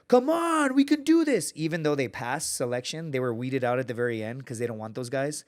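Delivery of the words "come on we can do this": high, loud fake motivational yell; mock screamed hype. Candidate yelling fake encouragement during Air Force selection. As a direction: pump up the team with forced enthusiasm. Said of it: "screaming and like".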